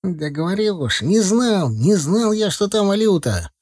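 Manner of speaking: high, wailing self-defence; desperate protest. A narrator's reading. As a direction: insist on innocence in a high voice. Titok protests his innocence.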